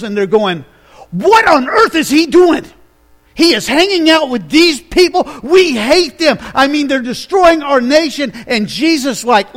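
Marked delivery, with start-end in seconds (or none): 1.1-8.3 indignant Pharisees. Acted. Pharisees outside complaining about Jesus.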